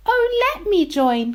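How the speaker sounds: high hopeful mouse call; high little mouse voice. Acted. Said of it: "called Little Mouse".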